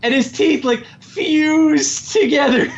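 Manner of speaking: building to the punchline in disbelief; incredulous. Real, not acted.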